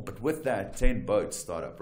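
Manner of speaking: ordinary talk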